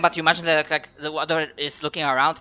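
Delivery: ordinary talk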